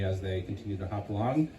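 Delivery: usual speaking voice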